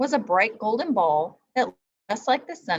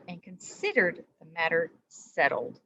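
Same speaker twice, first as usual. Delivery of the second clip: ordinary delivery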